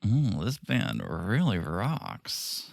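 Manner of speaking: drawn-out, very slow and soft Sandy parody; Sandy impression. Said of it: "that's exactly how Sandy sounds".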